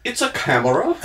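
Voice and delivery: put-on a playful English accent, laughing a little. Answering [name] from the front row in a mock English accent. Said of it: "in an English accent".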